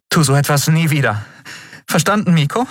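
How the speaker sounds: high, firm scolding; stern captain. A narrator's reading. Endriel sternly orders Miko never to do it again. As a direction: scold him fondly.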